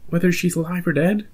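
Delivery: incredulous fan reaction; mock-stunned question; shocked disbelief.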